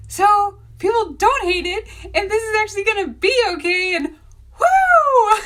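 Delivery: high, loud, emotional, building to a whoop; overwhelmed relief. Retold or imitated. Author learning readers don't hate the cover, overwhelmed relief. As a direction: let relief spill into a squealed cheer.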